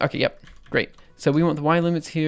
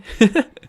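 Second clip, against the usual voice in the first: short laugh; 7 semitones higher, 7 dB louder than usual. Real, not acted. Laughs at own search.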